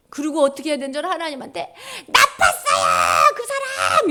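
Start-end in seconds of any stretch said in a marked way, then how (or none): 2.1-4.1 loud, drawn-out yell of grievance; shouted complaint. Acted. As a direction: yell it out to God. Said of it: "하나님한테 소리 질러야 돼". (하나님께 억울함을 크게 소리치며)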